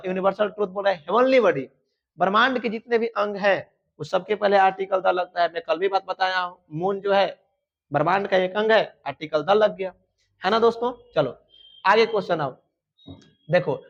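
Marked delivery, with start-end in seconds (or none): none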